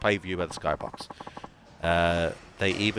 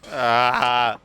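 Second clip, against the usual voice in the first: loud high wail of defeat; anguished wail; 5 semitones higher, 7 dB louder than usual. Acted. Beaten pitcher wailing in defeat. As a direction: howl like he's about to cry. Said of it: "No need to cry".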